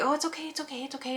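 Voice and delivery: put-on high, soft baby-talk reassurance; soothing the cat. Owner softly soothing her cat during a blood draw, high cooing.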